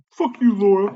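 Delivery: high, put-on jeering voice; mock taunt. Showing how he cursed the manager, high mock taunt. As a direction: re-enact a jeer in a high voice. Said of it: "Not real loud, just like".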